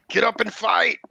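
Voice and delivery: high, loud angry yell; furious shout. Acted. Omar yells at the fallen girl, furious shout. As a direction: roar at her to get up and fight. GM voices Omar, repeated louder.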